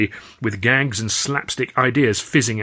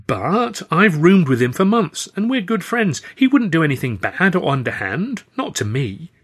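higher, earnest Stan; 7 semitones higher, 4 dB louder than usual. Acted. Stan insisting Charlie is his good friend, earnest and higher. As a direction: plead it, sincere and higher.

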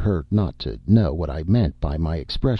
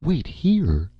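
sharply raised, incredulous question; indignant cry; 11 semitones higher than usual. A narrator's reading. Flushed Madeline springs up at the narrator, indignant cry. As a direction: throw it back, high and affronted. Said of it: "she cried".

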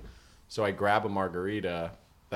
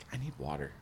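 soft, low, understated request; not urgent; 7 semitones lower, 11 dB quieter than usual. Retold or imitated. Fainting man asks companion for water, understated and quiet. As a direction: say it faintly, without any urgency. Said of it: "not urgent".

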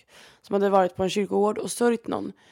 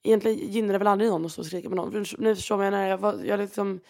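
ordinary delivery

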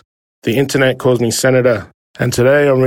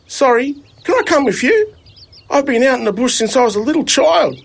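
put-on higher, eager young fella's voice; young Elvis; 11 semitones higher than usual. Lost bush kid asking the driver for a lift, eager. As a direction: ask it brightly like a hopeful bush kid. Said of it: "Elvis said".

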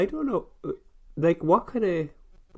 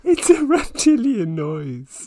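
spooky teasing voice; 11 semitones higher, 5 dB louder, slower than usual. Pretend.

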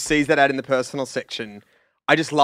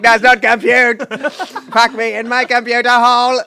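slow computer robot voice; Deep Blue robot; 6 semitones higher, 5 dB louder than usual. Acted. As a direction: play a talking chess computer. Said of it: "dressed as Deep Blue".

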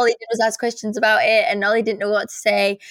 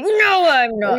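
laughing denial; 4 semitones higher, 4 dB louder, much slower than usual. Retold or imitated. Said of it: "I'll be like".

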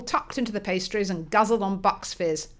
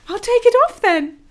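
high, loud, cheeky shout; playful cheeky shout; 9 dB louder than usual. Acted. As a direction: call it out with glee. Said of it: "shouted Kizzy gaily".

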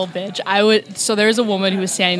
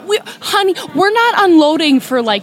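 sassy mock scold; 6 semitones higher, 3 dB louder than usual. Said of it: "mock scolding fellow passenger".

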